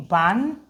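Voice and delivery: BAN said alone, cut off before its k; word split up for learners; sounded-out word. Shown as a demonstration. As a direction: break off "ban" and leave the k separate. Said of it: "this is bank".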